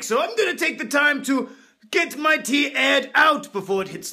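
pompous upper-class toff voice; hammed-up snooty aristocrat; posh rich man. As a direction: lord it over the poor peasants. Posh rich man character throughout.

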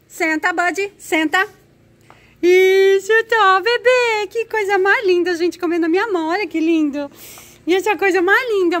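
high, loud sing-song pet talk. Real, not acted. High cooing at the dog.

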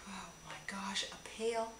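breathless whisper of delight; whispered oh my gosh, awestruck; awed whisper. Real, not acted. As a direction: hush it like you can't believe it. Awed over makeup gift.

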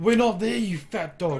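loud excited yell; shouting. Real, not acted. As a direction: yell it mid-raid. Shouting during base raid.

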